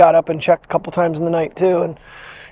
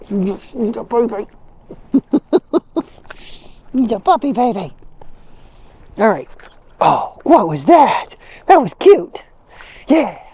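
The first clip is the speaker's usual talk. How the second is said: pet talk; 4 semitones higher than usual. Real, not acted. Cooing at the dog.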